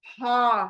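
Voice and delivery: हा breathed from mid-throat; throat letter. Shown as a demonstration. Teacher showing the throat letter to the class. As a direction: push the h from the middle of the throat. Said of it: "गले के बीच के जो letters हैं".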